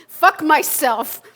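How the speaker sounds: drawn out, loud and bitter; slow bitter yell. Acted. Man about to hang himself cursing himself, slow loud bitterness. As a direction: hurl it at yourself, slowly. Self-hatred before the noose.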